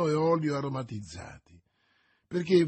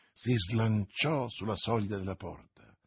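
plain reading voice

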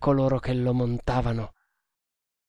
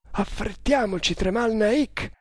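higher-pitched, restrained urging; urging suggestion; 7 semitones higher, 3 dB quieter than usual. A narrator's reading. Nagor hurrying his friend away, restrained urging. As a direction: urge him on in a raised pitch. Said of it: "suggerì Nagor".

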